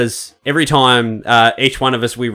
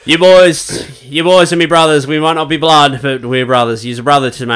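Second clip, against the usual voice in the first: ordinary delivery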